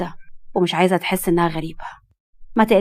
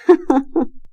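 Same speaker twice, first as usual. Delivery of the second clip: laugh; 6 semitones higher than usual. Acted. Mother laughs at son's request.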